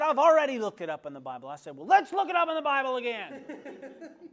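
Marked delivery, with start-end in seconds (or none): 0.0-1.5 Joe's voice raised high and loud; loud retort. Retold or imitated. Joe snapping back at the pastor, high loud retort. 1.7-4.3 high and loud, laughing through it; laughing retort. Retold or imitated. Pastor laughing back at Joe, high loud reply.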